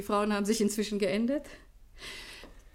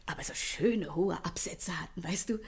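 hushed, breathy confiding whisper; whispered aside; 4 semitones lower, 4 dB quieter than usual. Acted. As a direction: lean in and whisper it like a secret. Confiding a girlhood escapade.

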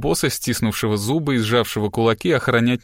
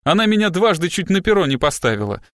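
higher-pitched heated protest; indignant; 6 semitones higher than usual. A narrator's reading. Boss protests to his assistant, incensed. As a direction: protest hotly, pitch raised. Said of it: "взвился Страйк".

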